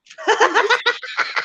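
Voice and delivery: a burst of high, loud laughter; laughing. Real, not acted. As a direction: crack up at your own joke. Laughs at own tissues joke.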